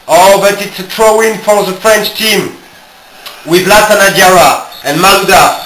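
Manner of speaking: fast-flowing football commentary full of oh's; excited match commentator calling the game; live play-by-play. Acted. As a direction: call the action as it happens.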